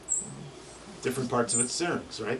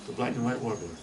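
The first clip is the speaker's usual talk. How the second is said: normal talking voice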